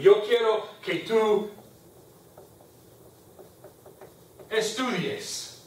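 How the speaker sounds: drawn-out "estudies" after a pause; slow down and let "estudies" land last; slow model sentence. Shown as a demonstration. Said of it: "And that's an E, not an A".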